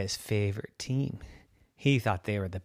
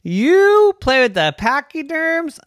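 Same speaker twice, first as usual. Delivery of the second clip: high, louder scoffing question; mocking crowd; 8 dB louder than usual. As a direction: laugh off the idea. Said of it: "And then they laughed".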